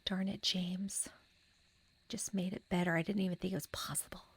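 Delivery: soft breathless whisper; awed whisper. Real, not acted. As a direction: whisper playful awe at the developer. Hushed wonder at the game.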